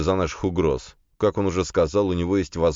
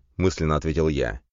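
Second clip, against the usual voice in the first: usual speaking voice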